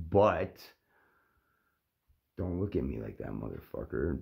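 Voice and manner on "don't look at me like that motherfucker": deep Dracula-like growl; low, dark vampire voice. Real, not acted. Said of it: "Don't make me sound like a vampire".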